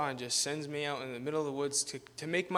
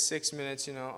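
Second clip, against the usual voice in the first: normal talking voice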